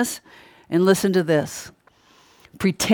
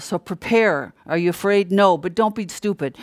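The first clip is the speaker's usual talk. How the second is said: normal talking voice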